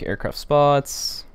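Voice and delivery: ordinary talk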